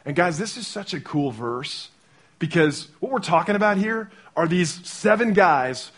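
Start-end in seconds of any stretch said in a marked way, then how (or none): none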